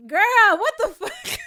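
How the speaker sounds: loud, high laughing disbelief; shocked outburst. Real, not acted. Vlogger reacting to the road manager, stunned and laughing.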